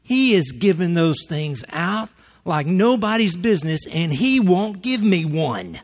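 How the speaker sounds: loud, high aggravated complaint; hammed-up griping that slows and drags at the end. Area manager complains about his brother-in-law's jackets, aggravated. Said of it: "he was aggravated".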